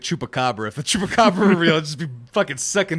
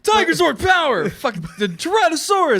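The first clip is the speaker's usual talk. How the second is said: hero battle cry; 12 semitones higher than usual. Retold or imitated. Hammy Power Rangers call.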